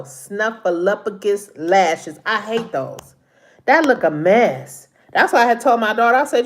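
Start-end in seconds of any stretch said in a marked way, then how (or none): 0.0-2.2 "snuffleupagus" spelled out syllable by syllable, very slow; said slowly. Shown as a demonstration. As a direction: slowly say "snuffleupagus lashes" so it lands right. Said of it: "I gotta say it slow".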